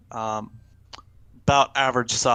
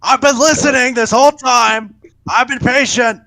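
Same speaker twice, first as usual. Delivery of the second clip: much higher and louder, yelling; indignant shout; 12 semitones higher, 9 dB louder than usual. Acted. Captive demanding help after waiting this whole time, shouting. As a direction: holler the complaint across the camp. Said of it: "comes a shout from the lean-to".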